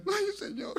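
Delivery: high-pitched little plaintive cry; acted small, high whimper of prayer; imitated mother's hidden prayer. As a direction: mimic mom praying under the covers. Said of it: "Allá calladito, mi mamá lo hacía bajo las cobijas".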